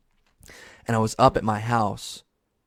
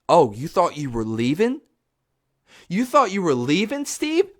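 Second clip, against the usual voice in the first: rising, higher-pitched gotcha questions; mock menacing taunt; 6 semitones higher than usual. Obsessed fan restarting episodes to trap Steve, mock taunting. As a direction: taunt him, climbing higher each time.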